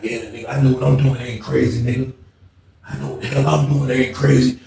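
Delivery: low, quiet imitation of self-talk; muttering oddball inmate. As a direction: drop pitch and volume, imitating his private muttering. Retold self-talk of the backwards walker.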